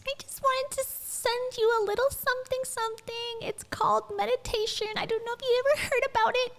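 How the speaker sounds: very high, sweet forgive-me voice; high-pitched, cutesy, apologetic imitation; Rachel Hollis's child voice. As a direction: mimic a little-girl voice, sweetly pleading. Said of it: "forgive me voice".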